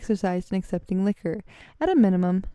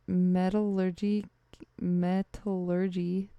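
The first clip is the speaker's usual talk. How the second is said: slow sounding out; 4 dB quieter, slower than usual. Real, not acted. Sounding out dictionary word.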